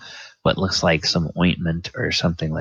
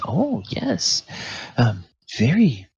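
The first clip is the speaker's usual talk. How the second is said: lightly voiced Morgana; 10 semitones higher than usual. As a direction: tut gently at the calluses. Morgana inspects hands.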